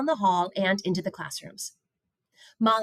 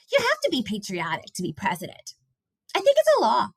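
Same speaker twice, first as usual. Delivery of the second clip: higher and louder at the end, sure of himself; confident schoolboy, lightly voiced; earnest boy voice; 4 semitones higher, 3 dB louder than usual. A narrator's reading. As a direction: state it like a solemn kid fact. Kareem character voice.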